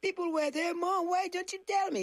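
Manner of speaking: put-on high complaining kid; indignant child. Daughter accusing her mom after the show, high complaint. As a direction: protest to mom like a betrayed little girl. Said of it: "But I said".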